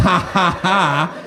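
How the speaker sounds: a hammed-up smug laugh; mocking fake laugh. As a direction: repeat the smug ha ha ha as mockery. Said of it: "to go".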